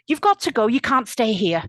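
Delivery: loud, irritated order; annoyed. A narrator's reading. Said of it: "really annoyed".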